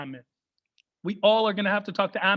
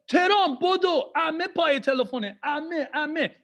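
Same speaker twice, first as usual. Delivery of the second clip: high, urgent, excited summons; excited dad; 7 semitones higher than usual. Acted. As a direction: call the kid to the phone, high and thrilled. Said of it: "He gets so excited".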